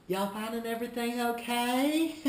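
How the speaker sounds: high, loud cheery question into a laugh. Shown as a demonstration. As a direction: overdo the friendly check-in, end laughing. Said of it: "Laughing's good".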